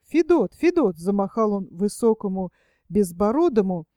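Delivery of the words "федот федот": calling out. A narrator's reading. Philipp waving, calling shepherd.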